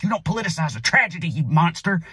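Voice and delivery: high, fast hammed-up indignation; mock outraged right-winger. Mocking hypocrites scolding gun reform advocates, fast and high. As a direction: scold like a fake-outraged pundit.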